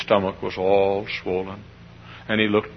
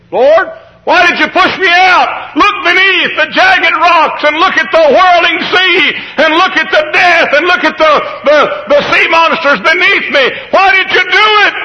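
anguished shouting; 11 dB louder than usual. Acted. Fallen believer questions the Lord, anguished shouting. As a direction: wail up to heaven in protest. Eaglet crying out to God.

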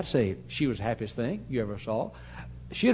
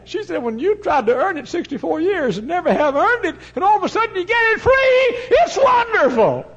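imitating an overwhelmed convert, high and building to a shout; overjoyed outburst; 8 dB louder than usual. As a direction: build from high, earnest pleading to a loud, elated cry.

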